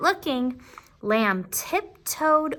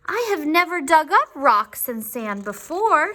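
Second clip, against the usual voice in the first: nervous backhoe; 6 semitones higher, 4 dB louder than usual. Acted. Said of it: "The backhoe was nervous".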